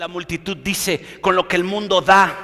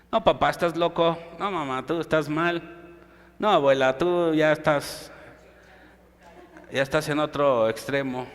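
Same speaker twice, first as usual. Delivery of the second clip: softer, lower, mock dismissive voice; children scoffing at parents' advice, softer mock voice; 6 semitones lower, 8 dB quieter than usual. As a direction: brush off the old folks' advice. Voicing dismissive children attacking elders.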